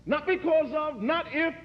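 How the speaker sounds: soaring, impassioned preacher voice; preaching at full force. Acted. Preacher to congregation, building to a fervent climax. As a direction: lift it up and drive the point home. Rising climax of love sermon.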